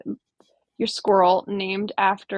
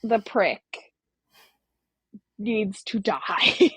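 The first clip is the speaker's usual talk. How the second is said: slow declaration; much slower than usual. Real, not acted. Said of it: "mock declaration".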